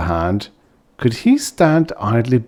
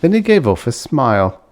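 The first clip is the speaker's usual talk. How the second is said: usual speaking voice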